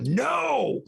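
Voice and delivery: high, drawn-out "no" yell; acted yell. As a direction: yell at the enemy in character, volume held back. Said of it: "just yell at them".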